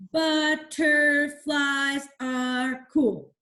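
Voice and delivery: very slow, each syllable spaced out for counting; syllable-counting read. Shown as a demonstration. Teacher reading a haiku to the class, very slow syllable beats. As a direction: tap out every syllable on your fingers. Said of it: "I like to go like this".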